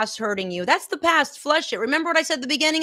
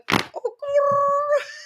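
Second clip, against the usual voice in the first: a sudden shrill laugh bursting out; high burst of laughter; 14 semitones higher than usual. Real, not acted. Coach cracks up while talking to her queens, high laugh.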